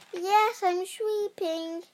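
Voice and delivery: high-pitched cat voice, lightly hissed; hissing cat. A narrator's reading. Cat posing as the girl for the witch, hissing. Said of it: "hissed the cat".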